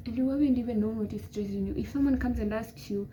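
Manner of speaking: ordinary delivery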